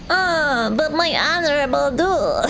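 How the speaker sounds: whiny high nerd voice. Pretend. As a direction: whine like an offended nerd. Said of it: "I did it in the nerd voice".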